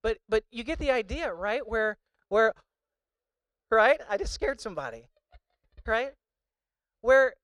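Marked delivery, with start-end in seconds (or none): 1.7-7.3 sudden startle. Real, not acted. Startles the crowd with tennis ball.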